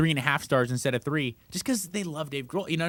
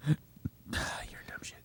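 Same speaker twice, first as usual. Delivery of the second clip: soft slow whisper with a sigh; whispered insult; 14 dB quieter, much slower than usual. Real, not acted. Cohost mocking his spending, slow whisper. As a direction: breathe the insult out.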